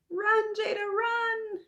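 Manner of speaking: very high squealed urging; high-pitched, drawn-out storybook alarm; urgent cheering. Acted. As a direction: cry out to the fleeing heroine. Narrator cheers on fleeing child.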